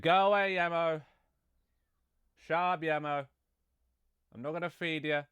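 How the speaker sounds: high-pitched scolding to the cat; shooing the cat. Real, not acted. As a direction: shoo the pet off in a squeaky high voice.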